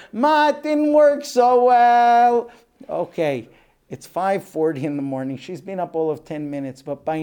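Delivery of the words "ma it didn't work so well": put-on high, very loud, drawn-out child voice; wailing little kid. Child calling mother after breaking a glass, wailing. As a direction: stretch it into a loud childish wail.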